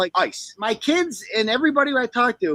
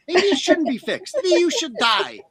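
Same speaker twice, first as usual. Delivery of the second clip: ordinary talk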